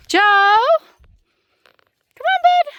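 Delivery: very high, loud call to the dog. Real, not acted. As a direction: call the dog back, urgently. Calling dog back.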